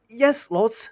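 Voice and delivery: high, quick honest answer; eager blurt. Acted. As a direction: let it slip without thinking. Bilbo blurts out.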